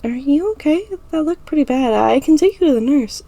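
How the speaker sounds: put-on high, worried girl voice; concerned friend. A narrator's reading. Ira offering to take Emma to the nurse, high and worried. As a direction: offer help, high and anxious.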